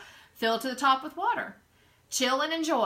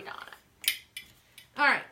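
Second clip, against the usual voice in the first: normal talking voice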